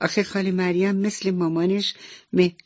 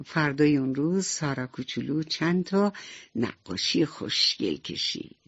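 plain reading voice

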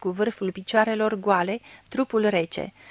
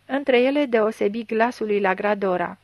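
ordinary delivery

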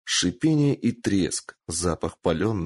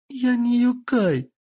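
high, whiny tearful plea; whimpering; 3 dB louder than usual. Acted. Tortured girl pleading with the lord, whimpering. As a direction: whimper like a hurt child. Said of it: "захныкала Кицуне".

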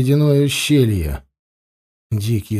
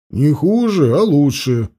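smug scoff; 3 semitones higher than usual. A narrator's reading. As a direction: scoff lightly and correct him. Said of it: "хмыкнул Анил". (самоуверенно хмыкнуть)

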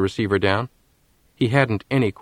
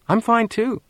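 put-on girl voice; 13 semitones higher, slower than usual. A narrator's reading. Girl's voice in story.

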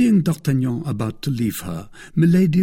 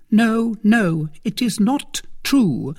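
high, firm denial; emphatic, high-pitched refusal; 11 semitones higher, 4 dB louder, slower than usual. A narrator's reading. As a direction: deny it flatly in a high woman's voice. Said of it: "tone of voice so firm".

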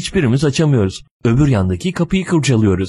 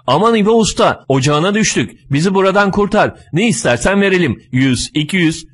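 higher-pitched, urgent begging; acted plea, pitch raised; pleading; 8 semitones higher than usual. A narrator's reading. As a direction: beg the repairman to help. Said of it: "İçeride yalvarmaya başladık".

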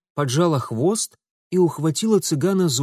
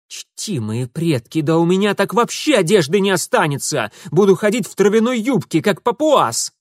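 higher and louder, rushing indignant rant; fast, loud mock despair; outraged wail; 7 semitones higher, 6 dB louder than usual. A narrator's reading. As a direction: build into a comic howl of outrage. Mitya's outraged comic complaint.

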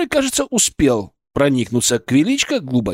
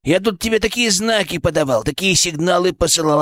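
squeaky little companion scolding his human; indignant cheeky creature. Acted. Creature scolds his human for missing his signals, indignant. Voices familiar creature Fyrk.

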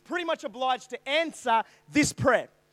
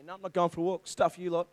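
sulky grumble; 7 semitones lower, 6 dB quieter than usual. Retold or imitated. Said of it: "I was so angry".

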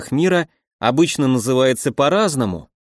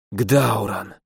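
deep distant rumbling giant; low titan voice; 5 semitones lower than usual. A narrator's reading.

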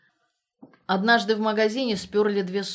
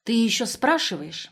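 indignant comeback, a bit louder; 3 semitones higher, 5 dB louder than usual. A narrator's reading. Наташка outraged that Светка even asks, indignant. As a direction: throw the question back in indignation. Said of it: "возмутилась Наташка".

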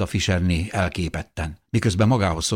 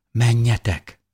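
higher, emotional urging; tearful plea; 5 semitones higher, 4 dB louder, slower than usual. A narrator's reading. Mother sends her family away, tearful. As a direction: urge them away through tears. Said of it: "könnyes szemmel".

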